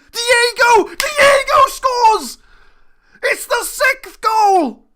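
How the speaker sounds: high, loud, drawn-out scream of joy; ecstatic goal shout. Real, not acted. Manager celebrating the sixth goal, screaming with joy. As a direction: yell it like the winner just went in.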